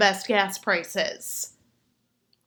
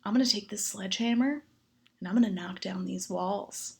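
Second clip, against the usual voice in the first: softer, low bedroom voice; mock seductive man; 3 semitones lower, 6 dB quieter than usual. The gentleman describing demolition, a soft mock seductive murmur. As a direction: murmur it like dirty talk. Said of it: "He's like".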